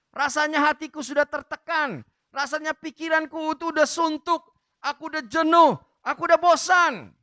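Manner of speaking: loud, high cry of someone who has had enough; fed-up outcry. Acted. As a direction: build to a loud, fed-up protest. Voicing the weary beggar's complaint.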